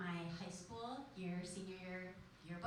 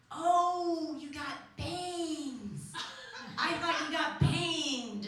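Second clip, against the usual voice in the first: teacher's slow reply; 7 semitones higher, 10 dB louder, much slower than usual. Retold or imitated. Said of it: "teacher is like".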